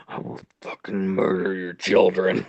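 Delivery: sinister unvoiced growl-whisper; menacing inner voice. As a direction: whisper it like a dark urge. Voicing his mean inner urge.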